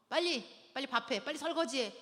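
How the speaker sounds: mock nagging to hurry up; acted bossy demand. Wife ordering tired husband to do chores, acted bossiness.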